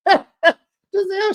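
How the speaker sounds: high, slow laughing name call. Real, not acted. Host laughing at Gizelle, calling her name slow and high.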